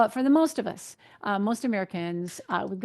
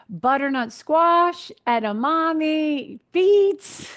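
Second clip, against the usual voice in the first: high, loud, drawn-out item names; playful announcing; 7 semitones higher, 6 dB louder, much slower than usual. Real, not acted. Dietitian calling out potassium foods, playfully drawn out. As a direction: stretch each food out, climbing higher.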